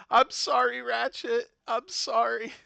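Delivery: high, loud, over-the-top sorry; loud apology. Real, not acted. Streamer to Ratchet, hammed-up loud apology. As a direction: yell the apology playfully.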